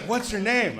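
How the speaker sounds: eager gossip. Retold or imitated. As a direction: jump in like she smells gossip.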